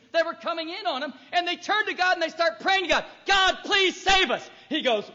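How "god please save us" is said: high, slow desperate plea; pleading Israelites. Pretend. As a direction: cry out to God, drawn out. Israel begging God, voiced.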